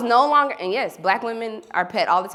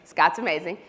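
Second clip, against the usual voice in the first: normal talking voice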